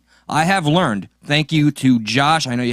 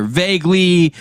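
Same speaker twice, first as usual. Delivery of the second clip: ordinary delivery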